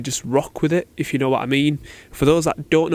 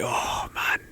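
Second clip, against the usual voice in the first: hushed, awestruck whisper to yourself; acted private swoon, barely voiced; smitten whisper; 8 dB quieter than usual. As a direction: whisper it under your breath. Acting smitten on seeing girl.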